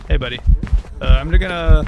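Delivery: panicked loud shout at the ghost; loud startled yell. Real, not acted. Ghost chasing, yelling.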